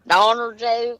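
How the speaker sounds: "Donner" with an -er ending; Donner for Donna. Retold or imitated. Teasing with the old lady's version, "Donner" with -er. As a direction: put an -er on the end of "Donner". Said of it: "That's the way the old lady says it".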